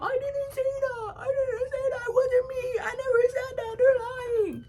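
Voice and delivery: very high, whimpering crybaby voice; mock crying denial. Mocking the girl crying when confronted. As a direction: whine it like a scared crying kid. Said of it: "Talk about".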